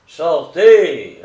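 very loud, high order to get out; furious shout. A narrator's reading. Attorney orders the boxer out, furious. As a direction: explode on the word, full voice. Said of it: "répéta Hobbes furieux".

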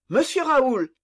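high, loud exclamation of recognition; cried out. Acted. Grimaud meets Raoul in the street, loud cry. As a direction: call out his name in surprise. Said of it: "s'écria Grimaud".

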